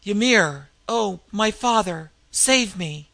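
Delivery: loud desperate cry to the heavens; a loud plea ringing across the snows. Acted. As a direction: call out to her father god, loud and pleading. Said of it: "cried out in a voice that rang".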